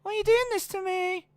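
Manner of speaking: very high squealed plea; Mandy Rose impression. Host mimicking a floored wrestler, squealed mock plea. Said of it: "just Mandy Rose on the floor going".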